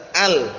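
"Al" with a plainly sounded l; distinct, separate "Al"; clear al. Shown as a demonstration. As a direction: pronounce the l of "Al" in full. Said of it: "nampak ketika dibaca jelas".

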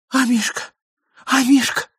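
high, anxious call; lady's cry. A narrator's reading. Lady missing her little dog, high cry. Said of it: "закричала дама".

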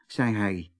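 plain reading voice

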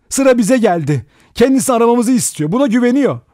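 loud, high, urgent warning; crying out. A narrator's reading. Vera warns Philip they are next, crying out. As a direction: keep shouting, high and insistent. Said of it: "diye haykırdı".

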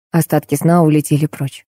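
usual speaking voice

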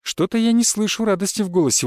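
high, lightly mocking goddess voice; amused goddess. A narrator's reading. Goddess needles the unhappy hero, amused. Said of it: "усмехнулась богиня".

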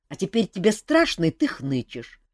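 ordinary delivery

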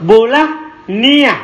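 "Bulah niyah" drawn out slowly, vowels stretched; slow pronunciation drill of "Bulah niyah"; slow, careful model. Shown as a demonstration. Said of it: "dhamma, fathah". ("Bulah niyah" diucapkan pelan sekali untuk dilatih)

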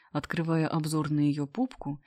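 ordinary delivery

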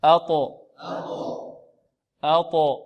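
أطو with heavy emphatic t bounced on release; thick, echoing stop in أطو for repeating; قلقلة الطاء. Shown as a demonstration. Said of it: "نطبق الآن". (أطو بطاء مقلقلة)

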